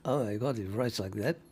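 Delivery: ordinary delivery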